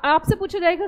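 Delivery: ordinary talk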